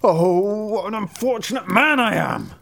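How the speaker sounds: high-pitched driver's wail; crying out. A narrator's reading. Driver finding his corn eaten, high wail. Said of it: "Then the driver cried".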